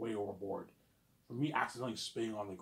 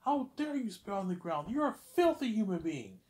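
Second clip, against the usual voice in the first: high, loud insult; imitated screaming teacher; mock teacher yelling; 10 semitones higher, 4 dB louder than usual. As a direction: hurl it like the raging teacher. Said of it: "She's like".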